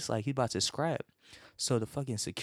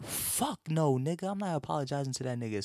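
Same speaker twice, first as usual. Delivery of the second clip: higher, fast defiant refusal; 5 semitones higher than usual. Retold or imitated. Refusing to apologize to the guy, fast high defiance. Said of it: "I was like".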